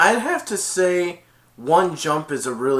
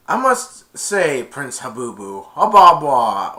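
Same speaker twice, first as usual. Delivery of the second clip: mock Jafar sneer; 10 dB louder, slower than usual. Child voicing Jafar's mocking line.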